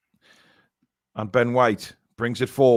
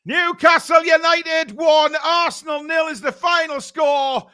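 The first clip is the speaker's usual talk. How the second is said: much higher, louder, slow triumphant shout; jubilant shout; 14 semitones higher, 6 dB louder, slower than usual. Real, not acted. Commentator to viewers, shouting the final score in joy. Final whistle, team wins.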